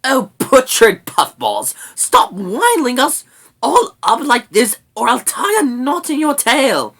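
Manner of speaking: high, loud threat; fed-up little creature shouting; exasperated brownie. A narrator's reading. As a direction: scold and threaten at full voice. Sorrel threatens the rat.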